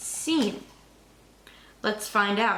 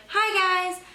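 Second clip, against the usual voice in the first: high, sing-song mock hello; cheerful greeting; 10 semitones higher than usual. Villagers on boats greeting each other, high cheerful. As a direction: chirp it like a happy villager. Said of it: "they're like".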